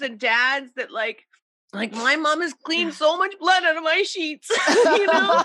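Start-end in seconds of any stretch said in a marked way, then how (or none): 1.7-5.4 higher, laughing while telling it; laughing through it. Real, not acted. Telling friends about her mom cleaning sheets, laughing. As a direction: giggle through the embarrassing confession.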